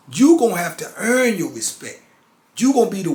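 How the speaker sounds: stern preacher's warning; forceful warning. Real, not acted. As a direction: lay down the law to the listener. Warning about prison respect.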